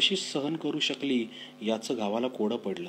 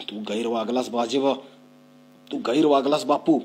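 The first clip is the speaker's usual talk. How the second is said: louder, rising rebuke; stern scolding; 6 dB louder than usual. Acted. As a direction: reproach him firmly. Father scolds son in quarrel. (कठोरपणे रागावून)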